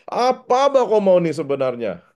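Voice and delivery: a hint of Sabah market Malay, casual; Sabah street talk. Shown as a demonstration. Preacher voicing Jesus in Sabah slang, lightly casual. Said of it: "kalau bahasa Sabah ini".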